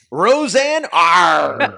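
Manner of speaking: laughing, loud pirate Arg; pirate growl. Pretend. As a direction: bark it like a jolly pirate.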